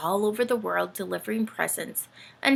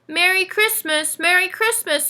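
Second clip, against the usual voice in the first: high, loud hearty greeting; booming; 11 semitones higher, 5 dB louder than usual. Acted. Uncle Benny arriving, booming Merry Christmas. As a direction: boom it out jolly. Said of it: "he boomed".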